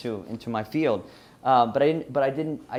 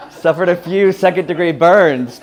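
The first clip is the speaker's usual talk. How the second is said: louder, higher, words squeezed out through laughter; laughing while talking; 5 semitones higher, 11 dB louder than usual. Real, not acted. Speaker confesses coal-walk burns to audience, laughing loudly. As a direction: tell it while still cracking up.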